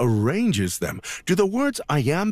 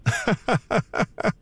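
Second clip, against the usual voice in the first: light laugh; 8 semitones higher than usual. Acted. Teacher laughs at student's quip.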